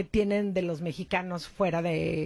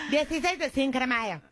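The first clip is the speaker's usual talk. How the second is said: put-on American accent; 3 semitones higher than usual. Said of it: "para los gringos".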